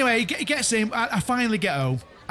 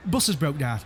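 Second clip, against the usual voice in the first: usual speaking voice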